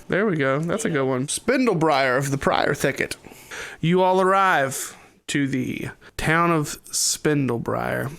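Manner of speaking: usual speaking voice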